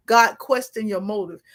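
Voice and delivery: ordinary talk, nothing marked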